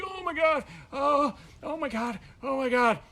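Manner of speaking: hammed-up agony; pained groaning. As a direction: moan it from the floor. Groaning in pain on floor.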